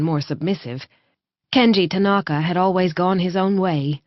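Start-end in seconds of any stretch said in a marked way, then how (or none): none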